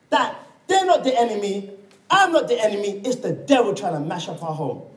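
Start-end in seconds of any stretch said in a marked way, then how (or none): none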